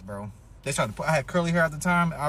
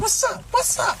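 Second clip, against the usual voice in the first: mock tough taunt; slower than usual. Bully squaring up to the narrator, high taunting challenge. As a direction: challenge him cockily.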